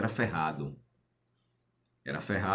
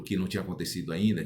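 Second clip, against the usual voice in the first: ordinary delivery, nothing marked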